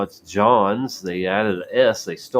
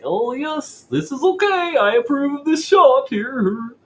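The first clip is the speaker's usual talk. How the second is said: high, mock-gentle Mister Rogers voice, pitched way up; Mister Rogers impression; 4 dB louder than usual. Mocking a grinning woman at her jab in a Mister Rogers voice. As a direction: coo it like a grinning kids' TV host. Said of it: "I don't know why I said it like that".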